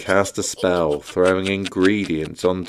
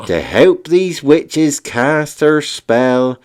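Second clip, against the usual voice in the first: rhyming spell; 6 semitones higher, 6 dB louder than usual. Acted. Wizard conjures cauldron for witches, loud incantation. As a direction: proclaim the rhyme like a wizard's spell.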